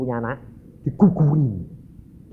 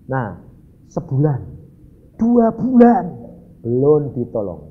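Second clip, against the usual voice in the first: ordinary delivery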